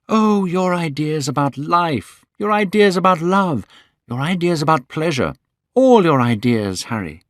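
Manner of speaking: put-on young Dorian Gray. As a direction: lift the voice for a young man in love. Dorian listing Harry's ideas.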